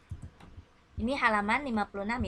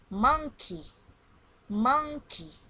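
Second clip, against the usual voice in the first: model word; 3 semitones higher, 5 dB louder, slower than usual. Shown as a demonstration. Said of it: "listen and repeat after me".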